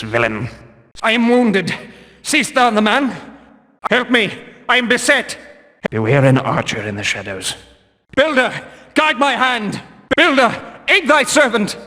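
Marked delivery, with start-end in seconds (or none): 1.0-5.4 high, urgent cries of a wounded man; calling for help. Acted. Wounded guard calls for help. 8.1-11.8 fervent battle cry. Acted. Guard prays mid-fight.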